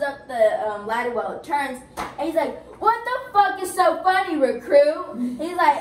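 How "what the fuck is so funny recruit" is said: raised, higher drill-instructor bark; mock angry demand. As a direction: confront a recruit in imitation. Acted officer confronting smiling recruit.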